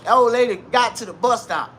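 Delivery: normal talking voice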